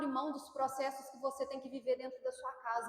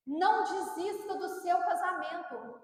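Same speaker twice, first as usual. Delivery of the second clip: normal talking voice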